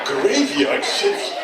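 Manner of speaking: "Graveyard shift" stretched very slowly, rising; mock disbelief. Shown as a demonstration. Comic mocking the American term, saying "Graveyard shift" slow and rising. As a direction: savour "Graveyard shift" as if it were absurd. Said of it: "Who came up with that anyway?".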